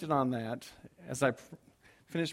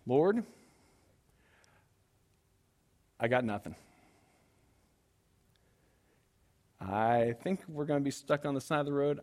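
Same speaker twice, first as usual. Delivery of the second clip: resigned prayer; 2 semitones lower, 4 dB quieter than usual. Retold or imitated. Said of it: "praying in despair".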